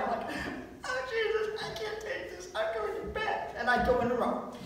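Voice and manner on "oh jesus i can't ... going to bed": much higher and softer, overwhelmed whine; hammed-up can't-take-it whimper; mock despair. Son gives up, going to bed.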